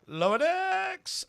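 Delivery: high, drawn-out show-title call; Mariner-style call. Retold or imitated. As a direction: stretch it high, imitating Mariner's yell without the volume. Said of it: "I gotta mariner that".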